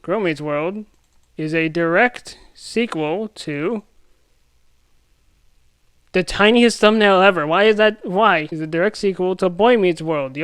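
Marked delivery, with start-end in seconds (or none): none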